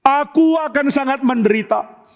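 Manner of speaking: high-pitched acted lament; grieving. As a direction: voice a father's anguish, pitch lifted. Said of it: "Daud makin sedih".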